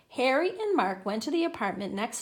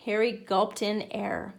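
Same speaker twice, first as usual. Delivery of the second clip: ordinary delivery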